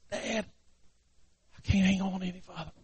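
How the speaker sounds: very soft, strained farewell plea; faint despair. Acted. Boy hanging by one hand to his dad, faint despair. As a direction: let the cry fade to almost nothing. Exhausted boy about to fall.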